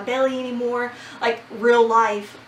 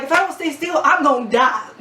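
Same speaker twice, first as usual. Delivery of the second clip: higher, laughing mock panic; 4 semitones higher, 4 dB louder than usual. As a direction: play up the terror with a laugh. Thinking during spinal shot.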